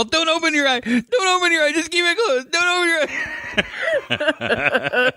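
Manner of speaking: panicked yell. Retold or imitated. Parent yelling at child in panic.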